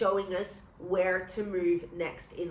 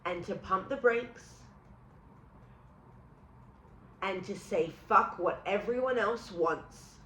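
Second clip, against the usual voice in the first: guided affirmations; 2 semitones lower, 5 dB quieter than usual. Acted. Healer guiding viewers through a tapping release, calm and soft. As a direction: talk the listener gently through letting go.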